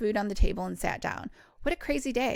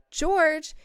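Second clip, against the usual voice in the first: higher, louder call to a dog; 7 semitones higher, 5 dB louder than usual. Acted. Owner calls to her furiously barking dog, calling out. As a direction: call the name sharply, high and loud.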